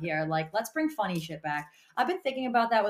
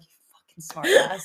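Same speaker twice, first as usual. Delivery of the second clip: mock muttered retort; 8 semitones higher, 5 dB louder than usual. Acted retort to her dad, whispery.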